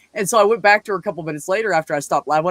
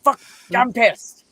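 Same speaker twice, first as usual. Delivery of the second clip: higher-pitched mock anger; retold pissed-off ex; 6 semitones higher, slower than usual. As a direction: play an angry girlfriend, lightly. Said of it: "And she'd go".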